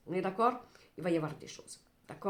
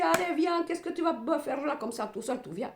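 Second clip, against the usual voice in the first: coaxing friends; 7 semitones higher than usual. Acted. Friends urging the lonely one to come out on Valentine's. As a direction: pull a friend off the couch.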